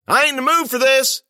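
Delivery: high, loud angry yell. A narrator's reading. Narrator yelling into the dark gym, loud and high. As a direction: yell it to scare off the noise. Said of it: "I yelled".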